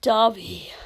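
loud, sharp answer; curt and forceful. Acted. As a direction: fire the name back angrily. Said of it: "Dobby said sharply".

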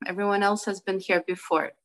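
ordinary talk, nothing marked

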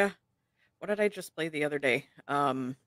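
tired, low and trailing; drained, barely awake voice; sleep deprived. Real, not acted. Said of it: "I am so sleep deprived right now".

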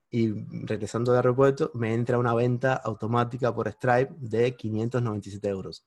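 usual speaking voice

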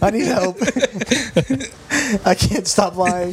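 high-pitched words between laughs; laughing admission. Real, not acted. Liar confessing to laughing friends, laughing admission. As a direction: plead for help through laughter.

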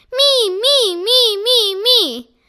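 high, loud chant of "me"; clamor to be chosen; shouting. A narrator's reading. The class shouts to get the pizza job, loud and high. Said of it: "Everyone shouted at once".

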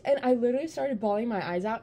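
ordinary talk, nothing marked

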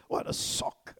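usual speaking voice

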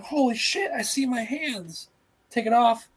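high, overacted shock; hammed-up shock at seeing his own hands; fake surprise. Shown as a demonstration. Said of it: "be like".

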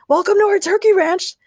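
high, loud eager greeting; a cheery rancher welcoming guests; Ray voice. A narrator's reading.

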